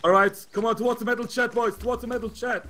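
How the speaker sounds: loud, high shouted orders; urging the squad forward; combat callout. Real, not acted. Squad leader calling movement.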